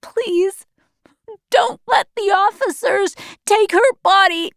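high, sobbing plea; distraught, rising child's wail; crying boy. A narrator's reading. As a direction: beg through tears as a grieving child. Grieving boy begs after mother dies.

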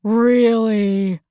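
drawn-out mocking question breaking into laughter; amused, scornful officer; laughing officer. A narrator's reading. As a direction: sneer it, then laugh.

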